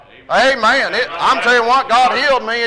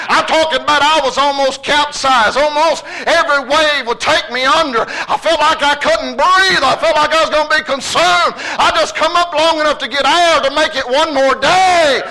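preaching; 5 semitones higher than usual. Acted. Sermon climax, raised preaching.

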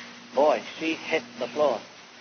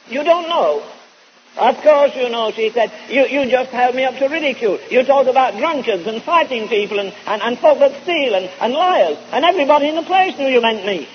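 indignant accusation; 7 semitones higher, 4 dB louder than usual. Retold or imitated. Woman confronting preacher, retold quarrel.

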